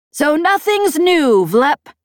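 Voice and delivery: high, loud, slow dry retort. A narrator's reading. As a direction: brush off the insult. Voiced Peg retort.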